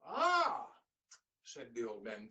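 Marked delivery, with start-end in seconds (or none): 0.0-0.7 high, loud grateful sigh; a weary old man's relief; relieved ah. Acted. Old man sighs gratefully at the bread.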